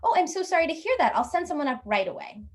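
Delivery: high, quick customer-service apology; apologetic hotel clerk. Acted. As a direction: gush an apology like a front desk clerk. Clerk apologises to guest in story.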